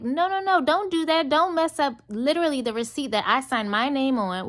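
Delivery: high, frantic "no, no, no"; panicked, stop her now; urgent protest. Retold or imitated. Stopping waitress from altering receipt.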